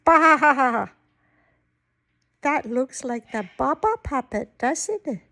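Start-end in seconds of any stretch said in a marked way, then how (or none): 0.0-0.9 long wavering baa; sheep bleat. Acted. Grandma reads the sheep's part, bleating. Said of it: "A sheep says ba".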